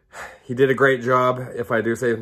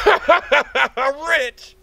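laughing; 5 dB louder than usual. Acted. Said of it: "I'm rich repeated".

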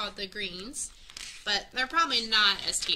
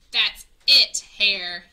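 much louder angry bellow; hollering; 12 dB louder, much slower than usual. A narrator's reading. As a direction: bear finally loses his temper. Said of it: "he hollered".

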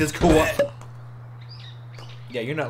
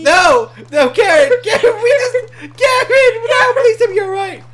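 high, loud, hammed-up grief; anguished shouting; 8 dB louder than usual. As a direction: scream for a lost friend, overacting. Game companion Karen seems killed.

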